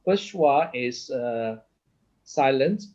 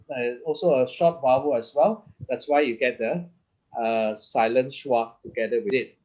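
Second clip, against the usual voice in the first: ordinary delivery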